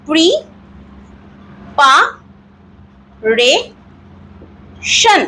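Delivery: Preparation as pri, pa, re, shan with long pauses; broken into four syllables. Shown as a demonstration. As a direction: isolate each syllable of preparation, very slowly. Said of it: "এইভাবে যদি আমরা". (Preparation চার ভাগে ভেঙে, অনেক থেমে)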